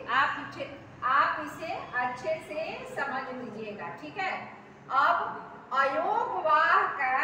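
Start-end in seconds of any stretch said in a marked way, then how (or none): 4.9-7.2 slow pronunciation demo. Shown as a demonstration. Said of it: "उच्चारण हम लोग देखेंगे".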